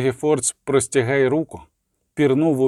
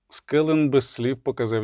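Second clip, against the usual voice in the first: plain reading voice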